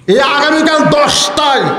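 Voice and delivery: acted-out intimidation; shouted threat. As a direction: bellow it as a menacing warning. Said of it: "এমন হুমকি মারছে".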